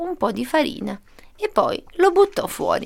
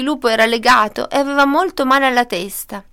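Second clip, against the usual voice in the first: usual speaking voice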